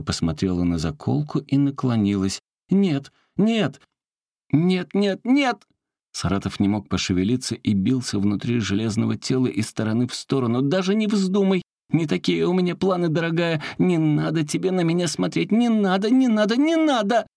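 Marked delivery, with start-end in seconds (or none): 2.7-5.6 high, frantic pleading no; panicked no. Acted. Девочка поднимает заколку. 10.6-17.3 frantic pleading. Acted. Заколка умоляет девочку.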